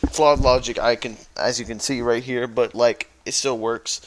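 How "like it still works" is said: ordinary talk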